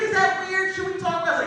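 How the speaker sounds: high loud timid woman's question; shy woman asking. Retold or imitated. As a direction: ask it high and unsure. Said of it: "She's like".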